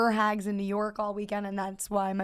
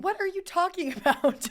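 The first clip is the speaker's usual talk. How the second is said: baffled; 9 semitones higher, 4 dB louder than usual. Real, not acted. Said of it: "baffled friend".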